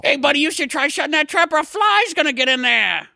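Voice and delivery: put-on squeaky sock puppet teasing the grieving kids; a goofy hand-puppet sidekick cracking jokes; Otto the sock puppet. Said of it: "Your mouth is moving".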